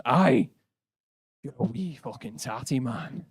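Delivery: put-on thick Glaswegian accent, rough and aggressive. Angry Glaswegian bloke insulting the visitors, thick Glaswegian accent. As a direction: bark it like an angry Glasgow pub bloke.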